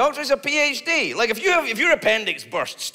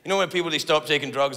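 ordinary delivery

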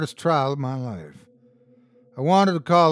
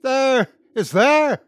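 high, loud shriek of terror; terrified shriek; 11 semitones higher, 8 dB louder, slower than usual. A narrator's reading. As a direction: scream as the thing appears. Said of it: "The sobbing prisoner shrieked".

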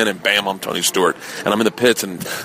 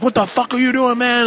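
high, yelled challenge; a hammed-up gang member hollering; mock angry yell; 13 semitones higher than usual. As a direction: shout at the thieves as you chase them. Gang chasing them.

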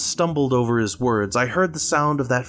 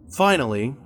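usual speaking voice

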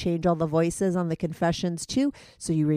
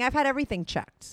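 usual speaking voice

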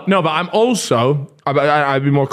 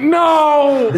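mock horrified no; 12 semitones higher than usual. Imitating a shocked refusal, high yelp. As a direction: yelp it like a scandalised lover. Mock reaction to sex talk.

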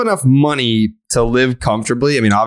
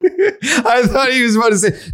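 laughing. Real, not acted. Laughing while speaking.

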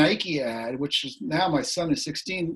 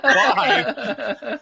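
mock question; 9 semitones higher, 4 dB louder than usual. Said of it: "With a question".

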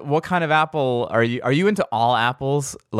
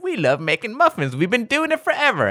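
put-on rising to a much higher, eager old-lady voice; sweet old ladies; 10 semitones higher than usual. Muffin ladies telling the show crew about baking, eager and high. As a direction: play them proud and excited about muffins. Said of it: "they're like".

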